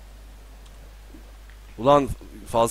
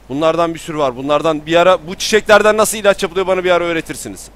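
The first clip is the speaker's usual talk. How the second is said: louder, fast eager talk; excited; 5 semitones higher, 7 dB louder than usual. Real, not acted. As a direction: gush about it. Heyecanla konuşuyor. (heyecanla hızlı konuşma)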